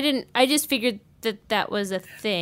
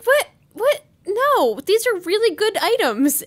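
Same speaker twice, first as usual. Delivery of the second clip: high-pitched, incredulous mock protest; mock indignant disbelief; 9 semitones higher than usual. Acted protest at rejected gifts.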